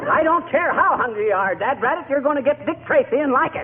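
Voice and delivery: put-on fed-up yell at a pestering child; hollering. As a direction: snap at the kid in frustration. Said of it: "excuse me for hollering at you".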